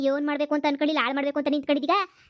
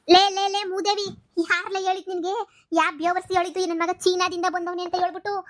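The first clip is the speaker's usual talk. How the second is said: furious outburst; 4 semitones higher than usual. Acted. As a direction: drag out the insult at her rival. First mother turns on the rival.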